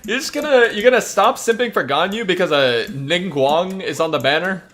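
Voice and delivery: high, loud, incredulous what; can't believe what they just read; outraged disbelief at a viewer's choice; shocked disbelief. Real, not acted. Streamer shocked by a chat comment.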